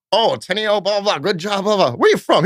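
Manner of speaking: lightly voiced careless owner; mock Rick voice. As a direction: play the distracted owner brushing off staff. Voicing the owner Rick's greetings.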